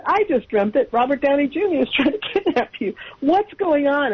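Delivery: high-pitched retold amazement; astonished mother. Mother telling the family her own matching dream, astonished.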